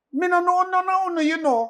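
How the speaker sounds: put-on little girl speaking Patois. Child to her new parents, in Patois. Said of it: "and I went".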